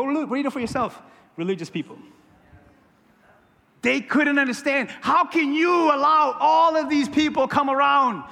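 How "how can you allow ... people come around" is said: loud, high, drawn-out indignant protest; indignant objection. Retold or imitated. Religious people challenging Jesus over the company he keeps, indignant. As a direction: raise the voice in scandalized disbelief.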